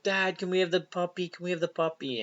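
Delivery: put-on high, quick kid voice begging dad. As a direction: plead like eager little kids. Kids begging for puppy.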